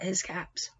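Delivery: soft hushed whisper. Real, not acted. Teacher whispering to a child as the peddler tiptoes off. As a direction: let the words fade to a breath.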